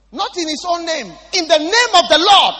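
thundering preacher emphasis; preaching shout. Real, not acted. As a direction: shout it out with full force.

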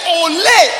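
Ole shouted high and loud. Acted. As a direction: belt Ole at the congregation. Pastor shouts Ga word at congregation.

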